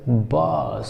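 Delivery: बास with long open aa, said softly; drawn aa in बास, spoken softly; soft teacher demo of बास. Shown as a demonstration. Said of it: "B O double S".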